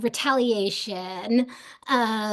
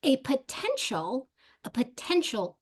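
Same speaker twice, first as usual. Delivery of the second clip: "potential" stretched slowly on its stressed middle syllable; deliberate, repeated weight on "potential"; emphatic stress on potential; 3 semitones higher than usual. Real, not acted. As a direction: hammer "potential", drawing out the TEN. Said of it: "I cannot emphasize that word enough".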